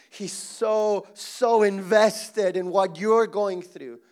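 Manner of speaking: ordinary talk, nothing marked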